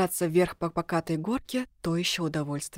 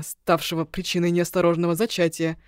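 plain reading voice

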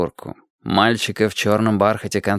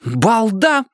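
put-on higher, snapping girl; flared up; 12 semitones higher than usual. A narrator's reading. Tina snaps at the teasing boy, high and angry. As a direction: snap at him in a temper. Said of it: "взвинтилась Тина".

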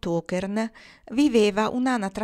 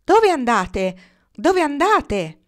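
high, loud call, the last one slower; shouted question; 7 semitones higher, 8 dB louder, slower than usual. A narrator's reading. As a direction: call up to someone passing overhead. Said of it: "uno di loro gridò".

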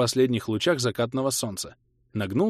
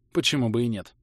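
plain reading voice

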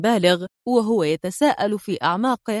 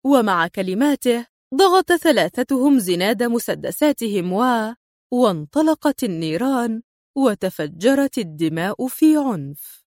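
usual speaking voice

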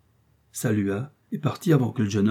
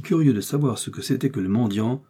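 plain reading voice

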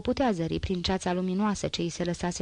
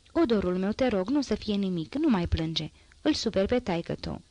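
usual speaking voice

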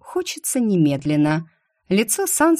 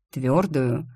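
plain reading voice

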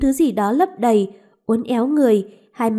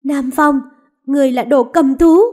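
louder, rising outraged cry; furious accusation; 3 semitones higher, 6 dB louder than usual. Acted. Mistress cursing the lord who condemned her maid, furious. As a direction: hurl the insult at him.

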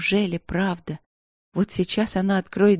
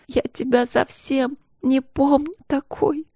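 put-on higher, slow, tearful little girl; little sister crying at her changed sister; 8 semitones higher, slower than usual. A narrator's reading. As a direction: wail it like a hurt child, slowly. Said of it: "плакала Каролина".

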